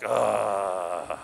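frustrated groan. Retold or imitated. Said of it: "I'm like".

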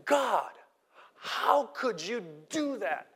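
high, slow whine at God; complaining believer. Retold or imitated. Believer accusing God of ignoring his prayers, high and whining. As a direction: plead with God like a wronged believer. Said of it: "Christians do this too".

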